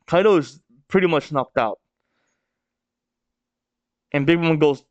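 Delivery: ordinary delivery, nothing marked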